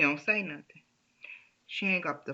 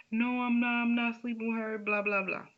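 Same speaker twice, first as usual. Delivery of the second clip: pleading husband; 6 semitones higher than usual. Retold or imitated. Husband denies, voiced by wife.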